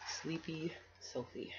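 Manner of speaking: plain reading voice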